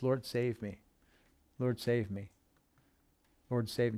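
soft repeated plea, barely voiced; murmured prayer. Shown as a demonstration. Pastor showing his own prayer, soft repeated plea. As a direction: pray it softly under the breath. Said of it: "I've prayed that so many times".

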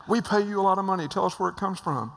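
fast, high, loud insistence; eager demand. Retold or imitated. Executives offering money to learn the source, fast loud demand. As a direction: rush it like you're desperate.

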